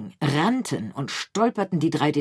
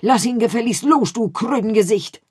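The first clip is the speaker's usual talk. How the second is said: much louder, higher scolding yell; angry shout; 7 semitones higher, 7 dB louder than usual. A narrator's reading. Pomme de Terre yells at the rat biting Picandou's tail. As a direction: bark the insult at the rat. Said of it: "biss die Ratte in die Nase und rief".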